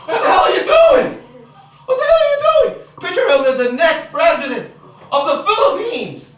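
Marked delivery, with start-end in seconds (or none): 0.1-2.7 high, loud horrified yell; hammed-up horror; horrified shout. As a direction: scream at him to stop. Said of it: "I'm like".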